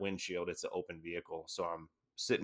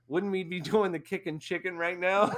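higher, laughing through it; 10 semitones higher than usual. Real, not acted. Veteran telling the host the punchline, laughing. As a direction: giggle out the punchline.

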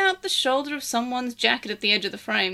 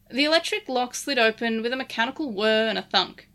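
ordinary delivery, nothing marked

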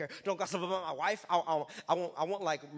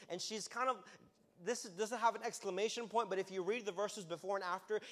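ordinary delivery

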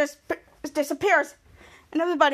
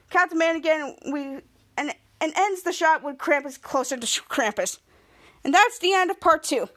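ordinary talk, nothing marked

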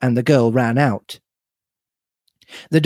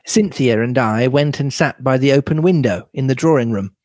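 ordinary delivery, nothing marked

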